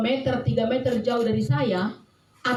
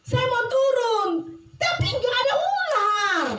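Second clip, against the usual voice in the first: slow, very high wailing call; acted child's long, high cry; 12 semitones higher, 3 dB louder, slower than usual. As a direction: call down from the tree, stretching the words. Child calls from the tree.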